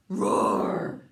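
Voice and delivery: big loud roar; dragon roar. Acted. Parent leads child in a loud dragon roar.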